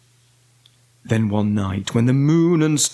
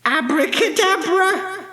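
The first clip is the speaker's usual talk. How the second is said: high, ringing witch's incantation; shouted magic spell. A narrator's reading. Winnie waves her magic wand, high shouted spell. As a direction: cry the spell out high, holding the last sound. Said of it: "waved her magic wand and shouted".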